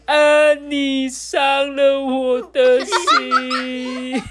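very slow, high, stretched-out wail; loud bawling. Acted. Lulu sits before the goal bawling loudly. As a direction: howl it like a heartbroken child. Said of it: "大声哭了起来".

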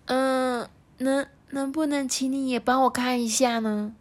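put-on high, stammering boy plea; hesitant child voice. A narrator's reading. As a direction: shyly work up courage. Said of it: "小健下定决心的说". (犹豫的小孩声音)

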